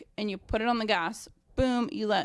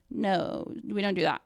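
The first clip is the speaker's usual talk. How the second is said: normal talking voice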